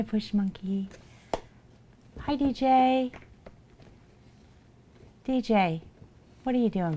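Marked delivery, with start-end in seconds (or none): 2.2-6.9 cheery greeting. Real, not acted. Greeting chatter, scolding cat.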